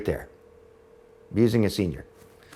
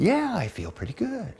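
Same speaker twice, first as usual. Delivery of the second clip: put-on giddy old mother; 11 semitones higher, 4 dB quieter than usual. Mother giddy after brownie.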